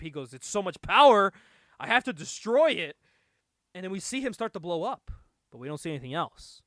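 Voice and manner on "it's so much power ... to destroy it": loud, swelling mock outburst; hammed-up cry of uncontrollable urge; overwhelmed by power. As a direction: play it as bursting with power. Acting out bomb overwhelmed by power.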